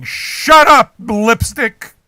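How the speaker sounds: high, loud shout; sudden full-voiced outburst. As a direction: yell to silence someone. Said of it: "I'm always shouting".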